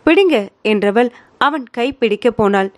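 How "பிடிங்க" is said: daring. A narrator's reading. She dares him to hold hand.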